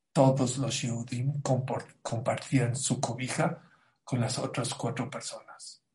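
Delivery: normal talking voice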